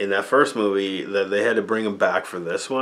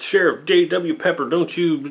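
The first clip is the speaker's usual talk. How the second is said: mock Southern sheriff; 8 semitones higher, 3 dB louder than usual. Reviewer mocking the bigoted sheriff, hammed-up Southern voice. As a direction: ham up the loudmouth country sheriff.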